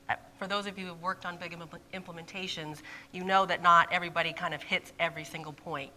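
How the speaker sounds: normal talking voice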